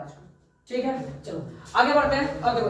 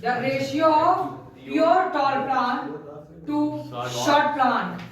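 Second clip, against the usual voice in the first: slow phrases with long gaps; slow dictation; much slower than usual. Real, not acted. Teacher reads the F two question to the class, slow dictation.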